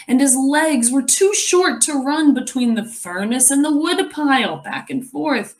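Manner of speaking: put-on lively read-aloud to children; story narration. A narrator's reading. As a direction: tell the story to the class, expressive. Reads the picture book aloud.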